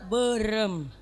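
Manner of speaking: low, drawn-out "Berem"; pelafalan Sunda bertenaga dalam. Shown as a demonstration. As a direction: say "Berem" from deep inside, soft and low. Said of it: "pakai tenaga dalam". ("Berem" dengan tenaga dalam, rendah)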